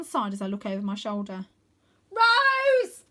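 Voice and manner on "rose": very high, loud yell; boy yells from the flying dragon. Acted. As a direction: yell her name. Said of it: "I yell".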